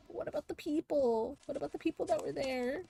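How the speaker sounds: anxious question. Acted. Still worrying about the villagers.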